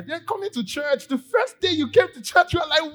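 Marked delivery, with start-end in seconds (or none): none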